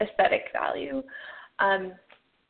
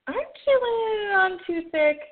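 much higher, teasing challenge; mocking Jinx; 11 semitones higher than usual. Jinx teasing her kidnapper about his letter, high mocking. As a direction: needle him with amused disbelief.

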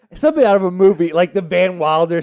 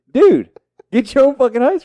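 higher, louder exasperated snap; annoyed retort; 8 semitones higher, 5 dB louder than usual. Retold or imitated. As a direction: bark it back at a pestering friend. Refusing friend's ice cream demand.

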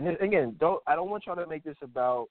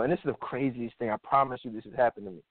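ordinary talk

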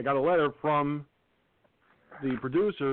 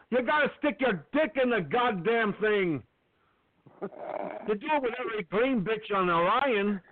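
cheesy Scotty impression; 8 semitones higher than usual. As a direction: ham up a flustered ship engineer. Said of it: "went to the impression".

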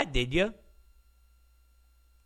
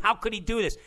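ordinary delivery

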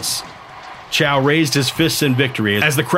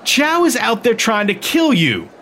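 worried scolding; 10 semitones higher than usual. A narrator's reading. Emmeline scolding Meade.

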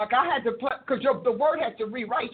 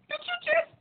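indignant disbelief. Retold or imitated. Indignant retort to God.

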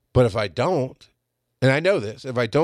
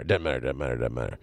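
fading mutter; 10 semitones lower, 5 dB quieter than usual. Real, not acted. Speaker brushing aside his own tangent, fading lower mutter. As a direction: lower and softer, dying away.

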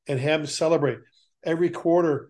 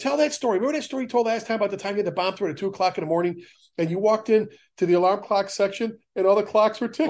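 much higher, fast excited pleading; eager rookie begging; 5 semitones higher than usual. Retold or imitated. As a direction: beg him to tell the old story again. Rookies begging retiree for stories.

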